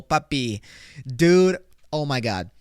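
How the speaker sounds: ordinary talk, nothing marked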